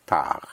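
French "tard" ending on the R, D silent; "tard" as French tar, no D sounded; French, silent final D. Shown as a demonstration. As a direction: say "tard" with the last D left off. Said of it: "you don't sound the D".

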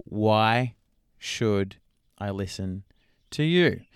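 "Why, should, I listen, to you" broken up by pauses; deliberate pauses. Acted. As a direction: separate "Why" and "should", then lift on "to you". Viewer's key question, spelt out.

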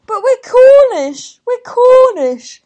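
high, loud, slow shouted plea; desperate protest. Retold or imitated. To police dragging them off, a high, loud desperate yell. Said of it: "are just going".

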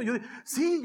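ordinary talk

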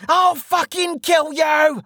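high, drawn-out screamed threat; furious scream. A narrator's reading. Wounded bandit leader threatening the archer, screaming in rage. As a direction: scream the threat slowly, each word forced out. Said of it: "the bandit leader screamed".

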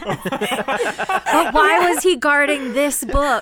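slow mock-puzzled question, cracking up; laughing question. Real, not acted. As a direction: ask it slowly through laughter. Cracking up over the joke.

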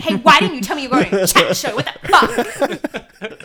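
hammed-up angry wife demanding answers; imitated furious wife. Wife confronts husband about the chat show, mock furious.

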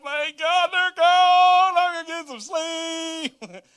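high, slow, drawn-out sigh of relief; mock relief. Tired dad to himself after drop-off, drawn-out mock relief. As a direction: stretch it out like a dad finally free.

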